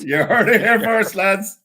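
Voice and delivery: high and loud, hamming up the catchphrase; mock hype announcement. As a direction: shout it like a big TV reveal. Mocking repeat of a friend.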